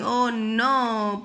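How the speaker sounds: usual speaking voice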